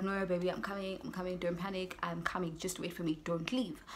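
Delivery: soft, imitated boyfriend reassurance; retold soothing plea. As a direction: quote him gently coaxing her to stay. Boyfriend begging her to wait.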